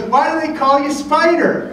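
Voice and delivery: put-on high-pitched curious little kid; Zach. Little kid asking about nickname, high kid impression. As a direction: ask in an imitated small boy's voice. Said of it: "And he goes".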